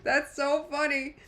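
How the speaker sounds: high, drawn-out words through laughter; helpless giggly delivery; real laughter. Real, not acted. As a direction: crack up while saying it. Streamer cracking up at chat comment.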